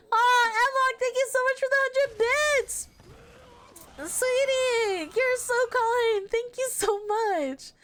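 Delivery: sweet thanks. Real, not acted. Thanking donor sweetly in stream.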